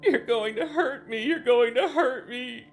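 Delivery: loud shrill tearful wail; high sobbing wail. Acted. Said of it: "He continued to sob".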